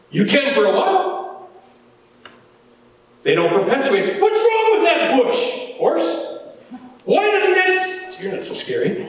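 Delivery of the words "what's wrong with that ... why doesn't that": high-pitched, puzzled questioning; acted-out bewilderment, pitched way up; baffled onlooker. Acts out puzzling at burning bush.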